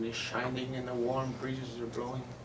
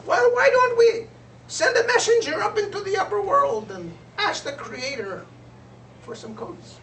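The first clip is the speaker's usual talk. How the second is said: much higher and louder, a small bright bird; little bird voice; 11 dB louder than usual. Acted. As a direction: pipe up like a tiny bird with an idea. Little bird proposes a messenger.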